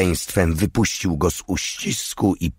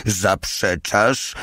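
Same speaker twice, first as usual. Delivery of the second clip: slow, drawn-out mock shout; 2 semitones higher, much slower than usual. A narrator's reading. The little one shouts at Stein while hitting him, drawn-out shout. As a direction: stretch the accusing question out. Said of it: "krzyczał Mały".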